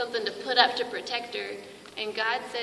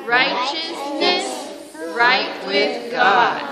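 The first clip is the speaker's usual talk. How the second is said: put-on slow, loud, drawn out for kids to repeat; slow call and response; 10 dB louder, much slower than usual. Shown as a demonstration. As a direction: lead the class in repeating it. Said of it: "Can you say that with me?".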